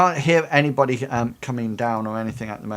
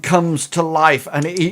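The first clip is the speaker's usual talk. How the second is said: normal talking voice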